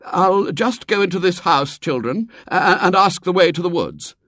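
high, hesitant, fussy man; harassed leader. A narrator's reading. Tall man instructing the children, flustered. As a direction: explain the errand in a fluttery high voice. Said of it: "The tall, thin man at the head".

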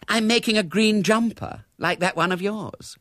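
high-pitched knitting chatter; fluting, eager small talk; gushing young woman. A narrator's reading. Gushing guest chats about knitting.

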